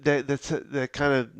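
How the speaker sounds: normal talking voice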